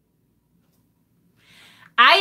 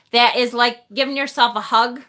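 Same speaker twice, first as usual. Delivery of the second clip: ordinary delivery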